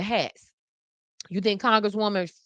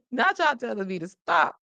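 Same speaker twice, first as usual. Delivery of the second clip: usual speaking voice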